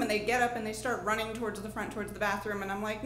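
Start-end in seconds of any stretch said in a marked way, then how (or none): none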